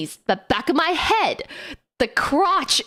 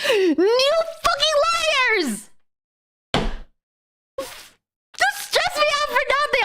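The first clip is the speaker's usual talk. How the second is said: slow, high, outraged shout; high indignant yell; 12 semitones higher, slower than usual. Retold or imitated. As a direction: call them liars, drawn out and outraged. Ranting at the airline.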